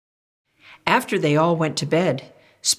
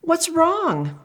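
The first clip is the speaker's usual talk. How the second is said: higher parent's concerned question; high caring question; 12 semitones higher than usual. Acted. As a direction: ask like a worried parent. Parents asking Spoon.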